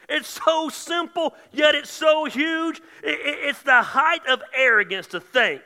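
high shouted rebuke, stretched slow on key lines; full-force preaching. Real, not acted. Preacher rebukes those wallowing in guilt, shouting at full force. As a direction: shout it out, hammering each short phrase.

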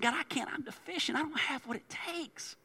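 small, high, soft protest; doubting Moses. Acted. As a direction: plead weakly, high and hushed. Moses doubting God's call, acted out.

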